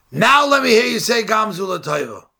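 high, loud mocking yell; screaming down a pit. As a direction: taunt him, shouting down into the pit. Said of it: "Screams down to the pit".

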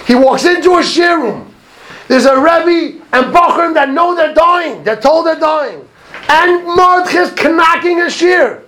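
high, slow, ringing exhortation; impassioned preaching. Real, not acted. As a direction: preach it high, drawing out the words. Preaches at full force.

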